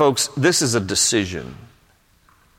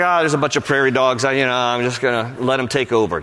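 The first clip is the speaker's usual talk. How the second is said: resigned. Acted. Imagined believer giving up on prairie dogs, mock resigned. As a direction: shrug it off like someone who quit. Mimics someone giving up the fight.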